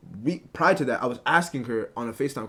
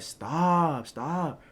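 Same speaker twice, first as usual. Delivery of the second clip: higher-pitched imitation of her protest; playful mock protest; 5 semitones higher than usual. Guy imitating his girl swatting his phone away, playful higher mimicry.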